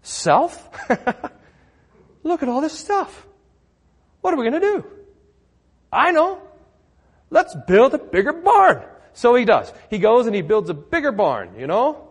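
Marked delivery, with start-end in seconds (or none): 0.0-8.8 high, eager voice of a man pleased with his harvest; rich farmer talking to himself. Retold or imitated. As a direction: pitch it up as a self-satisfied farmer plotting aloud. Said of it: "he says it".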